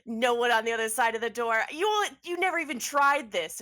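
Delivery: loud, high crowing laugh, then gloating; shrieking laugh of told-you-so triumph; triumphant laugh. Acted. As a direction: cackle in disbelief, then rub it in. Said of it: "she looks and she goes".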